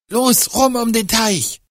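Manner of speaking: hoch und drängend rufen; aufgeregter Ruf. Acted. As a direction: hastig die Richtung angeben. Ruf bei Verfolgung. (aufgeregter Ruf)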